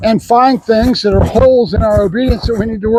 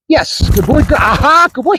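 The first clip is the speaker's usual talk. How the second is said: higher, quick bursts of praise; dog praise; 5 semitones higher than usual. Real, not acted. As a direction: cheer the dog on as he works. Praising the dog during drill.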